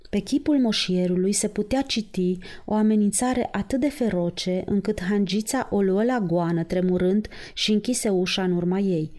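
ordinary delivery, nothing marked

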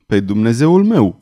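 usual speaking voice

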